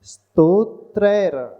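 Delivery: स्तोत्रैर slow, ending on a bare half र; correct pronunciation. Shown as a demonstration. Teacher showing students the half र in स्तोत्रैर. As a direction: say स्तोत्रैर slowly, final र with no vowel. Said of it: "उसका उच्चारण र के रूप में".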